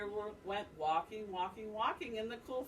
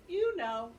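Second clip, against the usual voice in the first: deer's answer; 5 semitones higher, 5 dB louder than usual. Acted. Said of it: "said little bush deer".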